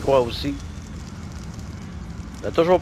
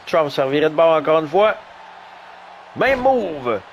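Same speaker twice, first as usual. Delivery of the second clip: normal talking voice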